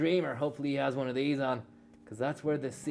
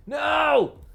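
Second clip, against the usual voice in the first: much higher, very loud no; furious shout; 13 semitones higher, 11 dB louder than usual. Real, not acted. Gamer shouts as he loses to his rival. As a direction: scream it as the race slips away. Losing the race.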